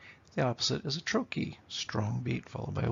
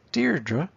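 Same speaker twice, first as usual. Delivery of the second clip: DEIR-dre, first syllable high and loud; strong-weak beat on "Deirdre"; trochee stress; 6 semitones higher, 5 dB louder than usual. Shown as a demonstration. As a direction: punch the start of "Deirdre". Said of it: "it's a trochee".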